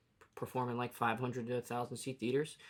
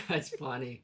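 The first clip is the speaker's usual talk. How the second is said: normal talking voice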